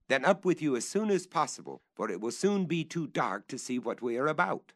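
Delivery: calling up. Acted. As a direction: call him up the tree before dark.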